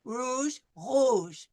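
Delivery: rouge with the r from the back of the throat; throaty r. Shown as a demonstration. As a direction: say rouge with a uvular r. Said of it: "comes from the back".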